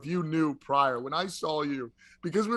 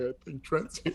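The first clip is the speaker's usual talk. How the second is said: hushed, softer imitation of the crowd; retold whisper; 6 dB quieter than usual. Retelling the crowd whispering about Trent, hushed. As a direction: murmur it like a rumor spreading. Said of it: "people started whispering, like".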